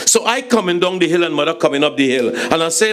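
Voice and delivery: ordinary delivery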